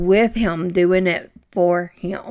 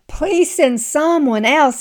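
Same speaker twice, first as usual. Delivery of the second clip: higher, slow, pleading; pleading Moses; 7 semitones higher, 4 dB louder, slower than usual. Retold or imitated. Preacher acting Moses begging God, higher and slow. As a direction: beg to be let off the hook, pitched up. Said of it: "he says in verse thirteen".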